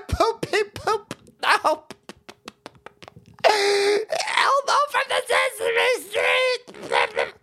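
put-on high, slow, wailing baby voice; mock sobbing crybaby. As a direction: blubber like a big baby. Mocking a crying Elmo fan.

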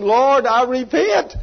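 high, slow, loud imitated plea; loud repenting. Preacher acts out Lisa repenting before healing, crying out loudly. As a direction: cry out like an eager penitent, stretching the words. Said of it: "Lisa is repenting".

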